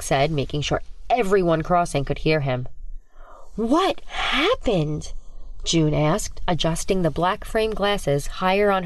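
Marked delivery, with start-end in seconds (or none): 3.5-5.1 put-on curious girl. June asks curious question.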